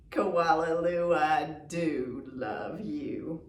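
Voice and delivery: a mother's tender refrain; tender mama voice. Acted. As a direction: say it like cradling a baby. Mama's loving refrain.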